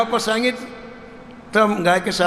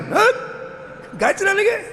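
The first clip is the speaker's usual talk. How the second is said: pitched way up in disbelief; incredulous; 12 semitones higher than usual. Acted. Guru to boy Dhanna, shocked and mocking disbelief. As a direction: scoff at the absurd claim, rising high. Guru hears idol herds cows.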